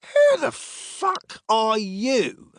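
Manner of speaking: slow, high-pitched challenge; angry demand. A narrator's reading. Centurion challenging an unknown man who threatened him, angry demand. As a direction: demand it high, stretching the words out. Unnerved centurion confronts Silas.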